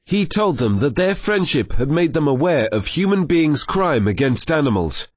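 ordinary delivery, nothing marked

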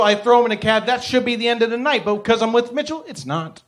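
ordinary talk, nothing marked

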